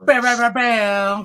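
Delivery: slow, loud, high hype chant; hype shout. Acted. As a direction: draw out each bam loudly. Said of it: "it's gotta be special".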